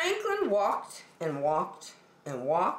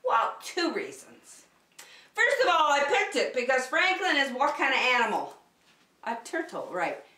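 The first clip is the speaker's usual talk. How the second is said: plain reading voice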